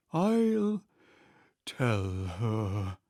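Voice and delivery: a hint of a deep, hollow mournful turtle. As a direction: drop into a hollow, gloomy voice. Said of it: "in a deep, hollow tone".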